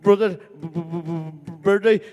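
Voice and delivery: halting, blocked stutter on each word; imitated stutter. Stuttering kid trying to sell dolls for his brother, stammering. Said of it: "get over my stuttering".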